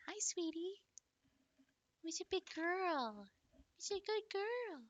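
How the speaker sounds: high, soft cooing pet voice. Real, not acted. Cooing to her dog Willow for attention. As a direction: sweet-talk the dog.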